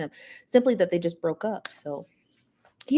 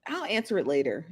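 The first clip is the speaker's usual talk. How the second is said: ordinary talk, nothing marked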